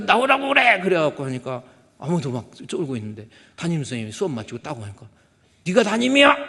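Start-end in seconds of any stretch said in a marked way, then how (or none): 0.0-0.8 high, loud bark; furious shout. Retold or imitated. Retold angry father at school. 5.7-6.4 loud, high challenge; menacing shout. Retold or imitated. Father confronting teacher.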